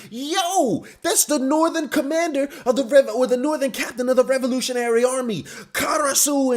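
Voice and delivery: hyped-up announcing. Acted. People reading out the bounties, hyped-up announcing. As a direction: announce the captain like big news. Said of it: "reading out the bounties saying".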